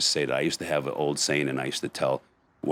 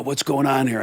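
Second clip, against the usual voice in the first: cop's demand; 11 semitones higher, 4 dB louder than usual. Retold or imitated. Cop confronting them at the scene.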